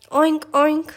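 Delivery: high oinking pig. Acted. Pig showing off its high-pitched oink.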